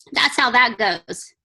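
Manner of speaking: usual speaking voice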